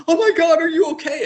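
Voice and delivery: high, loud panicked cry; nervous maid. Acted. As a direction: voice a frightened maid rushing to help. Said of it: "like, nervously run out".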